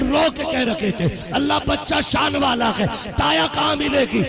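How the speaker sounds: high, impassioned sermon storytelling with a sung lilt; preaching at full force. Acted. Preacher telling the congregation of Halima, at full force. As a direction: preach the tale, rising and half-singing.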